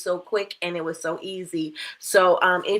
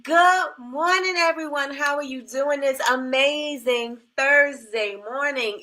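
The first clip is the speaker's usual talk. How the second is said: upbeat host greeting; 5 semitones higher than usual. Acted.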